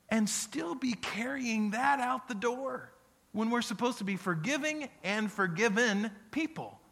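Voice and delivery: mock disbelief, high and pushed; exasperated inner thought. Pastor voicing his frustration at complainers, high exasperated disbelief. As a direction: ask it as if you can't believe them. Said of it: "And I'll think".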